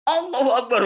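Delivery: re-enacted yell, high and loud; shouted takbir. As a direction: belt out the takbir to stay awake. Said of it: "saya teriak". (berteriak takbir dengan lantang)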